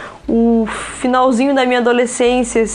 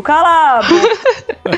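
laughing; 4 semitones higher, 3 dB louder, much slower than usual. Real, not acted. Laughing tease at Eric.

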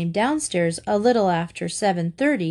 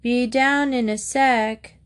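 slow, higher drawn-out answer; slow, slightly muffled reply; 5 semitones higher, slower than usual. A narrator's reading. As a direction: answer lazily from another room. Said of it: "a muffled voice answered".

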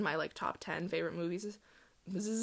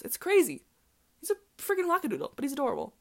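high, loud, fond gushing; excited gushing; 8 semitones higher, 7 dB louder than usual. Real, not acted. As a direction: rave about him, pitch up and loud. Gushing about Robert Pattinson.

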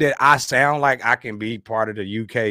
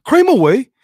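a loud, high, hammed-up British accent on "Cream of wheat", dropping the T; fake British accent; 8 semitones higher, 7 dB louder than usual. Shown as a demonstration. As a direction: lose the T in "wheat" for a mock British sound. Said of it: "so it can be more authentic".

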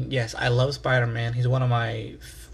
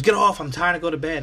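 mock yell, higher and louder; imitating the girlfriend's loud, pitched-up brush-off; annoyed girlfriend snapping; 4 semitones higher, 4 dB louder, faster than usual. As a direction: bark it out as the sleepy, angry girlfriend. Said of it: "She goes".

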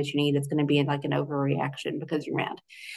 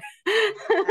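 real high laugh; short high laugh. Real, not acted. Guest laughing as she finishes her point, short high laugh. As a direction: laugh lightly at the end.

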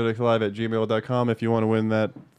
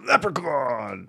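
trucker CB radio voice; 3 semitones higher, much slower than usual. Acted. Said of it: "give it in your best, like, trucker voice".

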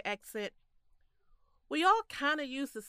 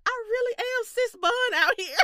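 high, loud laughing exclamation; 13 semitones higher, 5 dB louder than usual. Retold or imitated. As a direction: crack up while saying it. Laughing recount of her reply.

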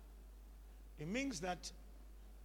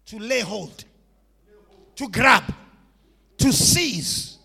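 rising louder on each verb; building shout; 8 dB louder than usual. Real, not acted. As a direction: build from firm to a full shout. Preacher builds the list.

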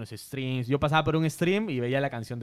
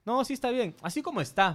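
a light, higher mock of the friend insisting; imitating his friend; 10 semitones higher than usual. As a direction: play the pushy friend, pitched up. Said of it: "me dice". (imitando al amigo que insiste, más agudo)

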